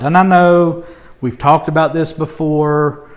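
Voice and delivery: ordinary delivery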